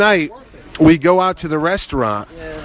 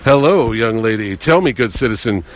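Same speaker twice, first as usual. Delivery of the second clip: low, hammed-up flirting; Adam West; 5 semitones lower than usual. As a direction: play a suave old TV hero chatting up a waitress. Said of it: "he was flirting with the waitresses and like".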